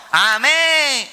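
high mock chorus of agreement; mimicked congregation amen. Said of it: "gritan aforadamente". (amén imitado de la congregación, agudo)

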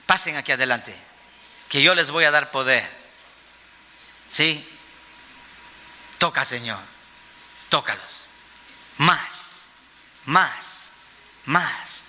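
low, softly spoken mock healer; mock faith healer. Mimicking a preacher handing out power. (imitación leve de predicador sanador, voz baja)

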